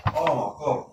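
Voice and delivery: hammed-up "oh my God" over good chips; delighted wife. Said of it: "She said, she went".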